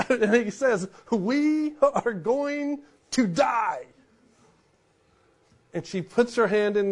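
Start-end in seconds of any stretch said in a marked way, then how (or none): 1.1-3.9 slow, higher, drawn-out mock dread; dramatic doom line. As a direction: stretch each word like a movie hero. Indiana's dramatic line retold.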